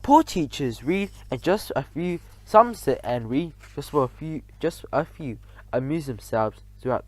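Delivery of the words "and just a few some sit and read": usual speaking voice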